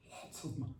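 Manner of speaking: hushed, breathy repeat; very soft whispered demand. Pretend. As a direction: whisper it as an aside. Whispered during the fake ransom call.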